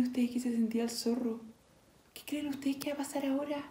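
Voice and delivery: put-on soft whispered question to the kids. Shown as a demonstration. Storyteller whispers a question to the children, whispered. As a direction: lean in and whisper it. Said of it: "whisper to children".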